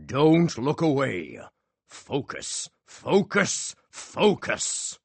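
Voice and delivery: slow, high, drawn-out magician patter; hypnotic showman. A narrator's reading. Magician urging the audience to focus, hypnotic. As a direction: lull them, then lift each focus.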